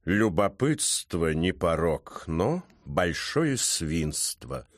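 low, very slow cat sage; ponderous, reproachful low drawl; Баюн, sighing. A narrator's reading. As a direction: sigh it out slowly, drawn-out and deep. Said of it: "вздохнул Баюн".

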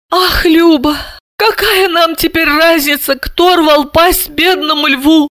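high-pitched, weary fussing; dismissive. A narrator's reading. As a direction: wave your sister's point away, squeakily. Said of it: "отмахнулась Альбина Яновна".

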